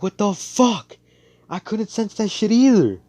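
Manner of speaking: high, loud indignant yell; hammed-up outrage at full pitch; acted outraged protest. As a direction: shout in disbelief after a slap. Acted protest after being slapped.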